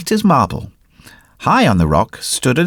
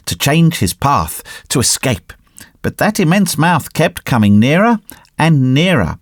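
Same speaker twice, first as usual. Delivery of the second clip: plain reading voice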